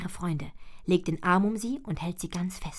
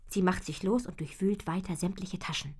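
usual speaking voice

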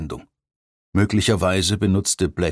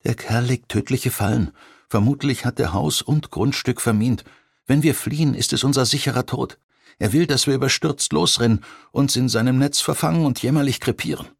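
lightly raised, steady warning; a hint of a tough agent explaining the danger; firm agent; 6 semitones higher than usual. A narrator's reading. Shauna warns November calmly.

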